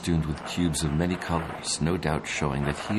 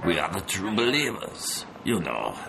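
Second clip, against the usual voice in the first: put-on bandit Mexican accent; 4 semitones higher than usual.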